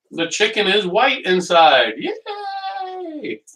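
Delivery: squeaky high delighted yay; real excited whoop; excited cheer. Real, not acted. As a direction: celebrate the done chicken. Chicken cooked, happy cheer.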